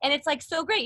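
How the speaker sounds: high, ringing face-placed voice; bubbly high resonant head voice; using the face. Shown as a demonstration. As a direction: lift the sound up into the whole face. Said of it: "in this voice, I'm actually using".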